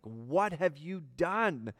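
God accusing Cain, slow and stern; accusing statement. Shown as a demonstration. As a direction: say it as a charge, not a question. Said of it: "It's an accusation".

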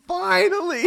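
very high, loud squeal of relief; hammed-up squeaky rejoicing; mock relieved cry. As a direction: exaggerate long-awaited triumph in falsetto. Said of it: "That was like".

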